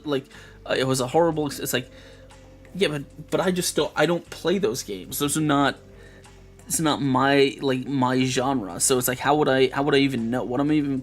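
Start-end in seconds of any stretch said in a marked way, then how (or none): none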